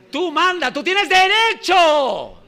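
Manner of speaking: lightly hammed-up preacher telling you to command; false teacher. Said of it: "te dicen".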